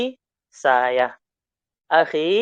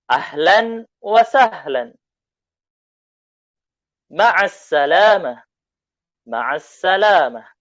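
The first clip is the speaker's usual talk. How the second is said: loud, slow, carefully spaced Arabic; loud and clear; 5 semitones higher, 7 dB louder, slower than usual. Shown as a demonstration. Teacher modelling the dialogue loudly for students reading along. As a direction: read it loudly so they can repeat. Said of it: "dibacanya dengan suara yang lantang".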